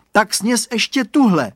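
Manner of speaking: high, loud urging; boy voice. A narrator's reading. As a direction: push another carrot on her.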